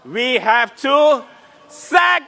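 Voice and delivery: high, loud rally cry; rally shout. Real, not acted. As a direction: rouse the crowd, shouting. Rally chant leading crowd.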